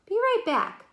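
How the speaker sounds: high, bright, quick little call; eager call. Acted. Annie runs into the tunnel, eager high call. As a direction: toss it off as she dashes away.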